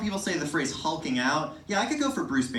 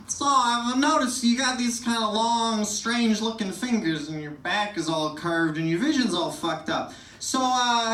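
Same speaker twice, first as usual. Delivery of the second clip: doctor's voice; 7 semitones higher, 3 dB louder than usual. Retold or imitated. Doctor listing the comedian's odd features, mock clinical. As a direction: lay out the flaws like a blunt doctor.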